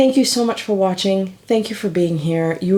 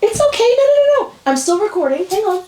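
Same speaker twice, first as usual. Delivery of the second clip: calling the puppy back; 14 semitones higher, 4 dB louder, faster than usual. Real, not acted. Stopping the puppy mid-recording.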